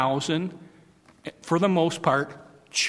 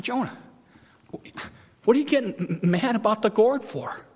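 God questioning; 5 semitones higher than usual. Acted. God questioning Jonah, paraphrased.

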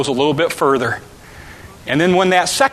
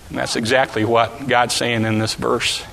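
usual speaking voice

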